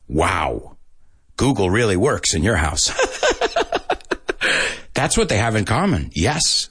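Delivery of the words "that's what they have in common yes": ordinary delivery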